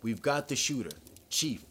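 crisp, urgent report. A narrator's reading. Officer checking on her chief by earphone, crisp. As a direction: report briskly, then check on your chief. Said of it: "said Lacoste's crisp voice".

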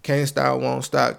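ordinary talk, nothing marked

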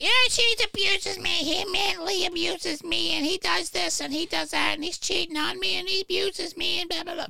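high, whiny impression of the wife complaining; mocking wife voice. Husband mocks his wife's complaint to the cop in a high whiny voice. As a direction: whine it out like the wife playing victim to the cop. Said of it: "She's like".

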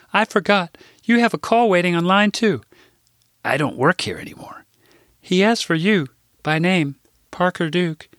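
put-on high, eager older Midwestern lady; chirpy assistant butting in with news; Rose's voice. Rose tells him about call.

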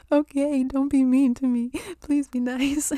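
pleading with the universe. Pretend. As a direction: beg the universe not to be mean, half joking.

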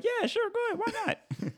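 high laughing squeal of a yes; high-pitched laughing "sure, why not"; mock eager yes. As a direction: squeal it out giggling like it's a no-brainer.

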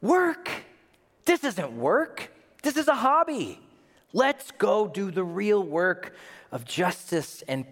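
high, incredulous protest; incredulous dismissal. Acted. Impatient visitor dismissing Jesus's carpentry, incredulous. Acted scornful reply to Jesus's work.

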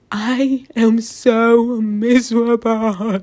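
slow, drawn-out mock lament; hammed-up self-pity; mock misery. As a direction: wallow theatrically, dragging every word. Mock-dramatic confession of misery, then laughs.

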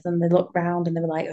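normal talking voice

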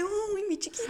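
put-on high, pitying baby-talk coo. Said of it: "me está viendo con unos ojos de". (imitando ternura compasiva)

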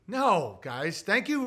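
normal talking voice

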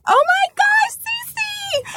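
very high squeal, imitating mom; thrilled mom. Mom hearing of the pregnancy, thrilled squeal. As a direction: squeal the name in delight. Mom's excited reaction, acted.

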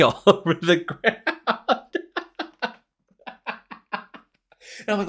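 breaking into real laughter mid-sentence; high, loud, helpless laugh through the words. Real, not acted. Storyteller cracking up as he recalls flinging the coffee, laughing.

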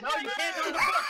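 shouting through laughter; laughing shout. Real, not acted. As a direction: yell it while cracking up.

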